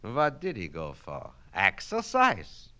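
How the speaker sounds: put-on Yiddish-accented Kaplan, holding forth grandly; Yiddish-accented immigrant student. Student lecturing his night class in a Yiddish accent.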